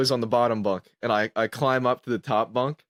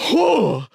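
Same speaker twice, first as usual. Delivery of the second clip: high, loud mock heave; sudden loud retching impression; imitated vomiting; 11 semitones higher, 5 dB louder than usual. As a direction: imitate throwing up with a high whoop. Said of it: "and I go".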